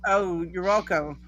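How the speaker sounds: ordinary talk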